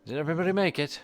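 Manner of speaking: high call down from the wall. Acted. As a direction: shout it down to the group. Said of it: "Archibald calls down".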